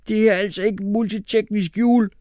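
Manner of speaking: high-pitched sulky complaint; sour grumble. A narrator's reading. Burnt boy complaining to the inspector, sour grumble. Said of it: "brummede Mohammed surt".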